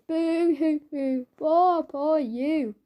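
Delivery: slow, drawn-out fake boo-hoo; sneering pretend sobs, dragged out slowly; mock crying. Mocking someone's tears.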